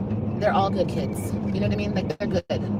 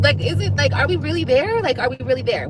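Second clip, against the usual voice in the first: disbelief; 6 semitones higher, 5 dB louder than usual. Retold or imitated. Mother voicing disbelief.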